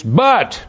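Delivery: "But" hit hard and high; pause, then punch "But"; emphasized But. Shown as a demonstration. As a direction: lean right into "But". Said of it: "I'm emphasizing the word".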